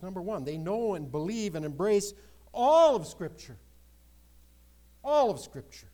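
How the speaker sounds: loud, high, hammering "all of Scripture"; full-force preaching; emphatic proclamation. Real, not acted. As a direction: drive the point home. Preacher emphasizing key point.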